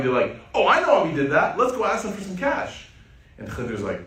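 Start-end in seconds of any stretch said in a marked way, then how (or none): 0.5-2.9 high, sing-song, faux-casual hint; a playfully naive proposal; mock-innocent suggestion. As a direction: slip the question in innocently. Musa hinting slyly at a question.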